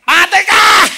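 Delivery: high, full-force shout; explosive retold yell; very loud yell. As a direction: scream the correction from the back row. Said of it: "teriak kenceng banget".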